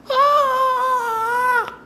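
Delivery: high, loud pained scream; cry of pain. Acted. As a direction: cry out as lightning strikes. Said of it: "cries out".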